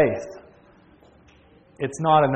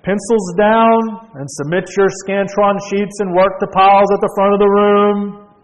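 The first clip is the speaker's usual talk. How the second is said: professor's announcement; 5 semitones higher, 5 dB louder than usual. Acted. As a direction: call it to the back of a huge hall. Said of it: "when the professor said".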